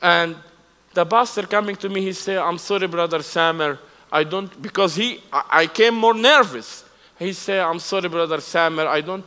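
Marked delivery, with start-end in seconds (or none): none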